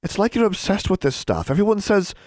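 high-pitched worried protest; girl's distressed appeal, voice well up; anxious remonstrating. Acted. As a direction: plead with a friend to see reason. Pleading roommate remonstrates.

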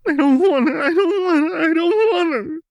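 high, loud wailing through sobs; crying. A narrator's reading. Jinx crying in Lux's arms, high loud wailing. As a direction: break down completely, wailing. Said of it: "Jinx sobbed".

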